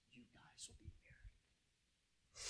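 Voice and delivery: slow, hushed whisper; prophetic whisper. Retold or imitated. Friend foretelling the marriage, slow whisper. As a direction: breathe it like a secret prophecy. Angela prophesying, discerning look.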